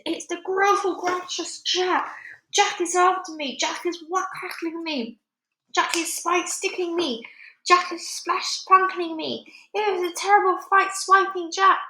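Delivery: high, frantic giant voice; panicked giant's bellow. A narrator's reading. Giant attacked by Jack in a nightmare, bellowing. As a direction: wail each complaint, pitched well up. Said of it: "bellowed the flesh lump eater".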